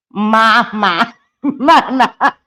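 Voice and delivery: exaggerated doll-voice "mama"; talking doll. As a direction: imitate a creepy doll saying "mama", laughing. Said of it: "It sounds like one of those dolls".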